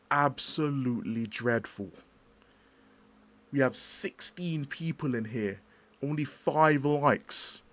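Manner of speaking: a lightly voiced Sava, slow and drawn out; slow pleading streamer voice asking for likes; Sava impression. Streamer begging his chat for likes, lightly doing Sava, slow. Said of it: "my impression of Sava asking for likes".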